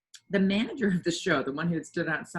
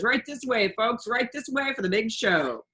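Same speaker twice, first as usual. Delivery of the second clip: barker calling; 5 semitones higher, 4 dB louder than usual. A narrator's reading. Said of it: "a man was shouting".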